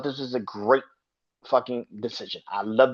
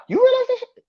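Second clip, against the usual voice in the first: high exasperated yelp; 5 dB louder than usual. Real, not acted. Ranting at audience.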